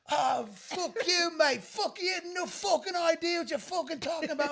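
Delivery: high-pitched, mock-outraged British accent. Drunk British guy up off his stool arguing, mock-angry British accent. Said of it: "stop talking to me in your British accent".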